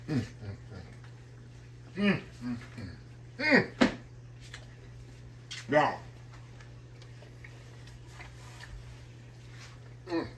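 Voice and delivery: closed-mouth mm of pleasure while chewing; satisfied eating hums; savoring hums. Real, not acted. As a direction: hum with delight over the food.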